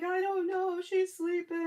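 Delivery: high, helpless retold whine; imitated upset daughter. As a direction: pitch it up like a distressed daughter. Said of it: "she calls me and she's bawling".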